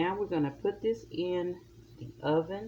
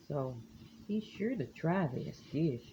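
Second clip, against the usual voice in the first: ordinary talk, nothing marked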